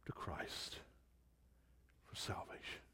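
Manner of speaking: whispered, pleading prayer; hushed prayer. Real, not acted. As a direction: drop right down to a whisper. Hushed plea within public prayer.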